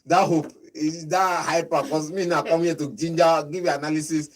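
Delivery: loud, high, worked-up pundit; excited rant. Real, not acted. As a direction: get heated about the team.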